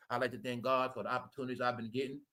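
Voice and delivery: low, soft, fast mock prayer of thanks; quietly hammed-up Steve Harvey gratitude speech. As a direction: mumble a hurried imitation of Steve Harvey thanking God. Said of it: "He said God so much".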